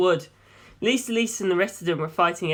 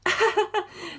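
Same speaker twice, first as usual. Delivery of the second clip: put-on young woman bursting out laughing, higher than the narration; burst of laughter; 14 semitones higher than usual. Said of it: "Darlington burst out laughing".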